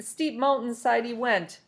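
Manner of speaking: ordinary delivery, nothing marked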